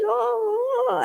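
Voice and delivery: high drawn-out whiny "oh"; high mock wail of complaint; mock whining. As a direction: imitate a child's long whine. Child's complaint retold to audience.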